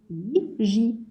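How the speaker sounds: usual speaking voice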